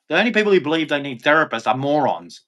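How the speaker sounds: normal talking voice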